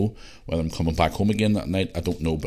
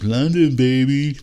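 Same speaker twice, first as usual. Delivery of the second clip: higher, louder hammed-up Joey cheer; Joey impression; 9 semitones higher, 6 dB louder, much slower than usual. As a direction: belt it like a sitcom guy showing off. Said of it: "'Cause Joey said it on Friends".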